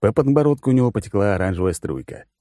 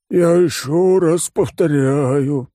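high, slow, weary drawl of a glutton; bored fat tycoon, tired of repeating; tired fat oligarch; 8 semitones higher, 4 dB louder, much slower than usual. Acted. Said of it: "усталым голосом сказал Жердяй".

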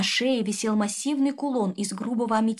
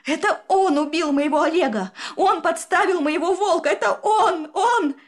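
higher, louder cry into sobs; sobbing scream; 7 semitones higher, 5 dB louder than usual. Acted. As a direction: accuse him as tears take over. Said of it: "Последние слова утонули в рыданиях". (крик сквозь рыдания)

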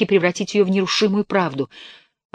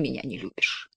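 whisper; 3 semitones lower, 8 dB quieter than usual. Acted. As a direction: let the last words fall to a whisper. Wife pleading, voice failing.

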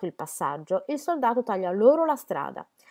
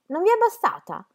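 taunting challenge; 11 semitones higher, 5 dB louder than usual. A narrator's reading. Soldier taunts, arm raised.

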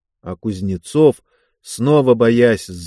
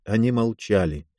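ordinary delivery, nothing marked

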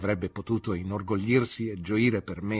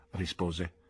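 usual speaking voice

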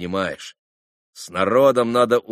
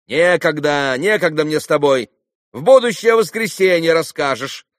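raised, higher voice, shooing away; loud brush-off; 6 semitones higher, 6 dB louder than usual. A narrator's reading. Boy brushing off a market woman, loudly dismissive. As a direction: wave off a pestering trader loudly.

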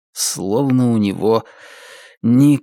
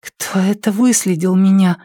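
put-on girl's voice; 7 semitones higher than usual. A narrator's reading. Trisha's voice, high.

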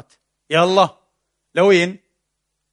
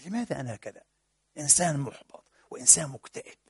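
softer, understated gloomy self-questioning; mildly dejected; 2 semitones lower, 7 dB quieter than usual. Acted. Depressed person stands and questions himself, mildly dejected. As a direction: quietly voice someone depressed asking himself.

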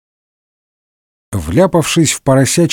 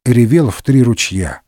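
usual speaking voice

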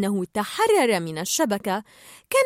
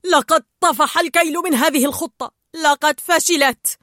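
loud, puffed-up outrage, slightly higher; angry shout; 4 semitones higher, 6 dB louder than usual. A narrator's reading. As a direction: shout it, fed up and furious. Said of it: "وصاح بلوت هو الآخر وقد انتفخ غاضبا".

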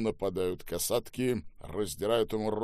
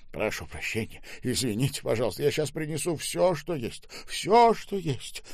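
apology climbing higher and more frantic; flustered babbling; 7 semitones higher, 5 dB louder than usual. A narrator's reading. Manager placates the raging boss, babbling in fluster. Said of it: "забормотал Михась".

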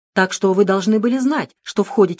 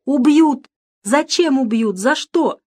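higher, louder tearful panic; crying; 8 semitones higher, 5 dB louder, slower than usual. A narrator's reading. Director bursting into tears at death threat, crying. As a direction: plead through tears. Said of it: "вдруг заплакал".

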